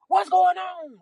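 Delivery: high, loud, alarmed demand; mama yelling. Retold or imitated. Mama on speakerphone demanding to know what's happening, yelling high. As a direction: yell it high like a panicked mother. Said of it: "She just yelling".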